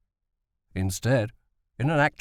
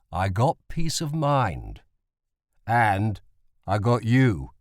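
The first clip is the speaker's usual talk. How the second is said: tender father; 2 semitones lower, slower than usual. A narrator's reading. Father tenderly answers son.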